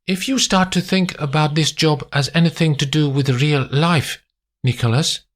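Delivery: high, composed clinical woman's voice; cool German woman Erica. A narrator's reading. Erica lecturing Nick on the job, cool and clinical. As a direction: speak it calmly, coolly detached.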